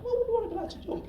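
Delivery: high, quick little inner voice; impish inner voice. Acted. The bit of brain at a cliff edge, high quick impish voice. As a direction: pipe it up fast and high. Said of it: "you're at the edge of a cliff".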